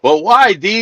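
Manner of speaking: normal talking voice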